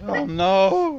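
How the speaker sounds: high squeal of laughing dismay. Real, not acted. Dad laughing as the globe rolls away, high and loud. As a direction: burst out laughing in mock horror.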